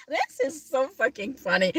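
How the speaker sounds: talking through real laughter; laughing. Real, not acted. Finds their plot absurd, laughing as she speaks. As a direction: squeeze the words out between laughs. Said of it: "I'm sorry for laughing".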